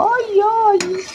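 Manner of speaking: sharp high "آي، آي" of a sudden burn; real pain cry. Real, not acted. Cook burned by boiling syrup while filming, yelping in pain.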